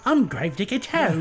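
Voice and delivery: unscary mock-British introduction; British accent Gravedigger Joe. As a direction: badly fake a British haunt character. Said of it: "This isn't scary".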